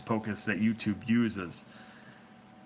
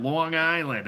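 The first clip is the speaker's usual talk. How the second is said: a louder, higher mock Long Island accent, stretched; 7 semitones higher, 5 dB louder, slower than usual. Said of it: "that's what he says".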